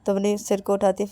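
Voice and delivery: usual speaking voice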